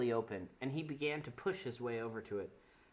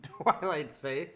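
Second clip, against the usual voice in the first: ordinary delivery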